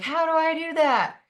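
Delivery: high, loud mock panic; freaking out. Panicking person asking how to breathe, in a high loud mock-frantic voice. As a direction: play someone panicking who can't breathe. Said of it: "somebody who's freaking out is like".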